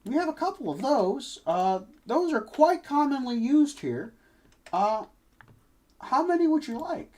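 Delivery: chirpy shopkeeper pitching wares. Acted. GM voicing shopkeeper.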